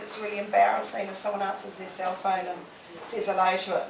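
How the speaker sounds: ordinary talk, nothing marked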